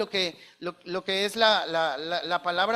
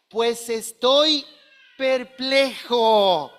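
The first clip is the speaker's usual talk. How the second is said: slow, louder, drawn-out puzzlement; bewildered apostle; 4 dB louder, much slower than usual. Acted. Pablo telling the Galatians, slow and puzzled. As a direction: stretch out perplejo in disbelief.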